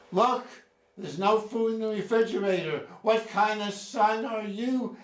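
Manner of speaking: high, loud, scolding mother voice; shrill the complaint like a mother who hasn't changed; nagging mother. Retold or imitated. Son voicing his mother scolding him about the empty fridge, loud and high. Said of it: "and you say, not having changed".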